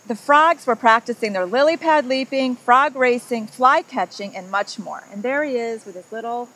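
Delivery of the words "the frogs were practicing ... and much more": lively read-aloud storytelling voice; story narration. A narrator's reading. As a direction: read it like a picture book to a child. Reads picture book to children.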